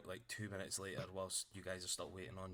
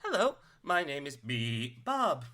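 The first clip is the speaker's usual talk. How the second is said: high, loud, slow robot introduction; measured small robot, syllable by syllable; little automaton; 6 semitones higher, 12 dB louder, slower than usual. Acted. Automaton introducing itself to a player, high robot voice. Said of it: "the automaton".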